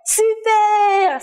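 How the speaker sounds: celebrating the winner with the class; excited cheer. Real, not acted. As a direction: cheer it like a game-show host.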